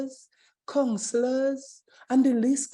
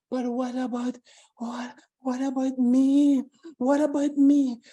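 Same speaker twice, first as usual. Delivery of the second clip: pleading; 2 semitones higher than usual. Acted. Paula pleading in acted story.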